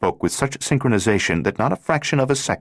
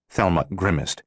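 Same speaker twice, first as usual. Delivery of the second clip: plain reading voice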